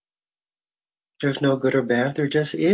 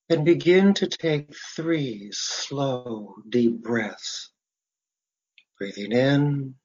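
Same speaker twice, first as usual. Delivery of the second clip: guided meditation; 3 semitones lower than usual. Real, not acted. Faculty member leading listeners through breathing and imagery, guided meditation. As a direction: guide them into relaxation, phrase by unhurried phrase.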